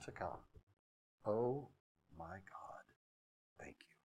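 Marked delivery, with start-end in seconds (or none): 1.3-4.0 hushed, low, reverent prayer; awed prayer. Real, not acted. Preacher turning to God in soft grateful awe. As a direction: breathe it out in quiet awe.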